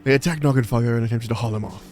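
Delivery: put-on amateur British stage accent. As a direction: read it in your best British accent. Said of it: "best British accent that, um, [name] can do".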